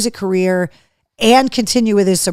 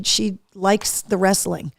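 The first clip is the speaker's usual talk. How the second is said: ordinary talk, nothing marked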